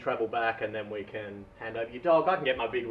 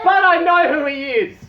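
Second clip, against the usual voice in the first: high and loud, still laughing; laughing lady's reply; 12 dB louder than usual. Acted. Lady on the island answers Dave, high and loud. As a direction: blurt it out in a raised voice.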